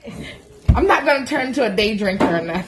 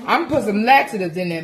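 ordinary talk

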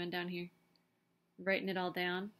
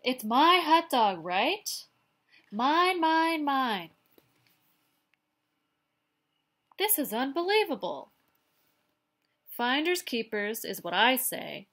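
an exasperated pigeon, louder and insistent; 5 semitones higher, 10 dB louder than usual. Acted. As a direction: stamp your claim on every mine. Pigeon loses patience.

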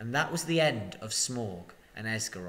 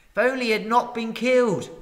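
lamenting crowd; 10 semitones higher, 7 dB louder than usual. Acted. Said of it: "they all said".

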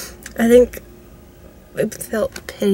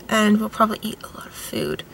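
breathy unvoiced aside; whispering; 10 dB quieter than usual. Real, not acted. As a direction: whisper it as a secret.

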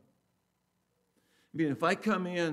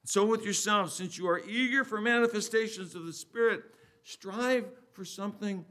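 loud, raised, emphatic Bible reading; scripture reading; 5 semitones higher, 6 dB louder than usual. Acted. Preacher reading scripture to the congregation, raised and emphatic. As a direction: proclaim the verse with deliberate pauses.

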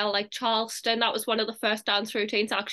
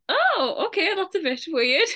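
high-pitched, louder mock surprise; mock taken aback; 7 semitones higher, 3 dB louder than usual. People reacting to a character's accent switch, high mock surprise. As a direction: jump up high, playing puzzled onlookers.

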